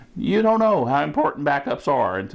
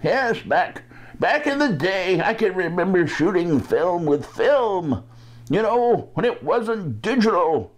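lightly voiced old man; a hint of a creaky old-timer. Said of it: "when I do my old man voice".

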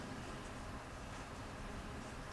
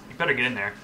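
ordinary talk

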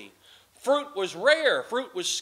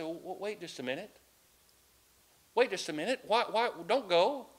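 soft, gentle call, much softer and lower; kindly voice; 3 semitones lower, 7 dB quieter than usual. Acted. King gently calling back the weeping woman, soft and kindly. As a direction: call her back tenderly, don't scare her. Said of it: "she heard a kindly voice".

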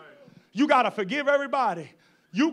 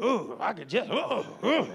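mock fuming groans; 4 semitones lower, 4 dB quieter than usual. Mocking a fuming person.

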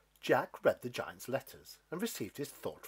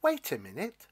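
put-on higher, louder storybook boy's line; animated character line, pitched up; lightly voiced Jack; 6 semitones higher, 5 dB louder than usual. A narrator's reading. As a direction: call out a little as Jack.

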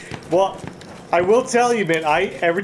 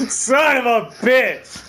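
laughing curse; 6 semitones higher, slower than usual. Real, not acted. Laughing after being killed.

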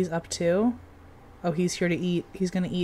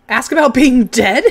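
reading the option through loud laughter; laughing; 10 semitones higher, 10 dB louder than usual. Real, not acted. Player amused by a ghost dialogue option, laughing. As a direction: crack up as you read it. Funny game option appears.

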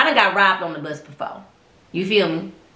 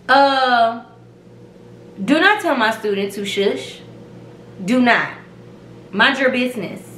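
stern telling-off; 3 semitones higher, slower than usual. Retold or imitated. Retold scolding of a stranger.

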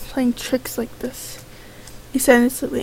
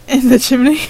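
loud insistence; 9 dB louder than usual. Acted. Character insists loudly.

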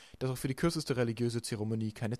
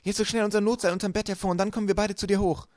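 louder, higher eager call upward; excited calling; 9 semitones higher, 6 dB louder than usual. A narrator's reading. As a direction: hurry her along, shouting up. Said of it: "rief Violet hinauf". (aufgeregt hinaufgerufen)

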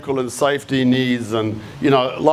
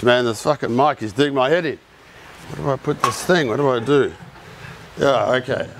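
ordinary talk

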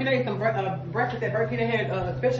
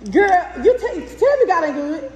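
ordinary delivery

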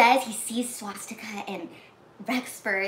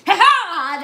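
loud, high "ha ha" burst; mock attacker's cry; 9 semitones higher, 13 dB louder than usual. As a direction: burst out like a pouncing villain. Imitates kidnapper springing his attack.

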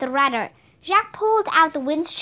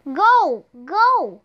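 shouting; 3 semitones higher, 4 dB louder than usual. A narrator's reading. Jack driving off the wolves, loud shouting. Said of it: "shouted Jack".

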